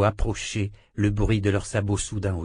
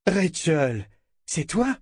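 surprised recognition; 6 semitones higher, slower than usual. Acted. Richard recognizes the little girl.

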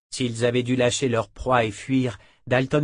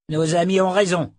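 higher, louder grumbled agreement; grognement; 7 semitones higher, 5 dB louder than usual. A narrator's reading. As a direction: growl it in firm support. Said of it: "grogna Morley". (grogné, ferme et appuyé)

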